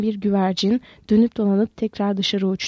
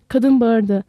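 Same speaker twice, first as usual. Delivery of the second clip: ordinary delivery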